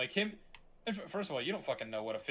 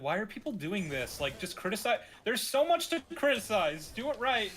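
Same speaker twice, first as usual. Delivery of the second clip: high-pitched exasperated rant; pleading with critics to make sense; 7 semitones higher than usual. Retold or imitated. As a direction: climb higher as the frustration builds.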